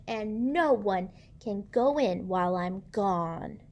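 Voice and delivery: loud and slow, every word weighted; all caps emphasis. Shown as a demonstration. Teacher reading George's all-caps order to the class, loud and slow. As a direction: hammer home the rule. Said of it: "The author wrote that with all caps".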